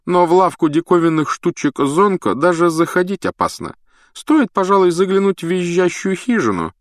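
high, lofty know-it-all voice; pompous prefect. A narrator's reading. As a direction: lecture them from on high.

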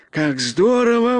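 high, gleeful bear voice; delighted exclamation. A narrator's reading. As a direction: exclaim like a good-natured bear pleased with a gift. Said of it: "воскликнул он". (высокий радостный возглас)

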